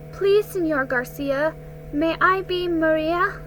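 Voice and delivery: put-on small girl's steady plea; small but steady. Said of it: "voice was small but steady".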